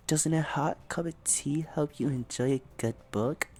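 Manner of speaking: whispered, breathless and soft. Acted. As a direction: whisper it like a secret. Yuri's soft line.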